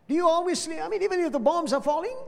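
high, fast incredulous question; high, fast disbelief; high, fast astonished retelling. Retold or imitated.

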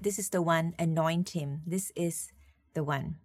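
a hushed voice of God; quiet, solemn. Retold or imitated. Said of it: "and then God said".

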